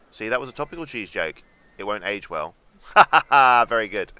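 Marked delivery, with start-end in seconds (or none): 2.9-3.6 mock laugh. Mock laugh at cheese pun.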